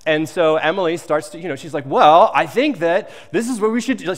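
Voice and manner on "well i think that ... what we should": higher and louder, arguing her point; raised voice. Retold or imitated. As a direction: push the plan hard. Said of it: "starts raising her voice".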